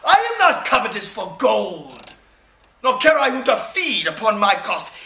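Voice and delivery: stirring theatrical recital of Henry the Fifth; rally the troops like a stage king; Shakespearean speech. Acted. Performs Henry's Crispin speech.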